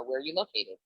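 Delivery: ordinary talk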